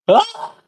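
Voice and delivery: short, high-pitched mock raspy yelp; raspy-voiced scream. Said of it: "the hack guy's raspy voice".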